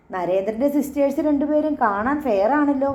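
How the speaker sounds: ordinary delivery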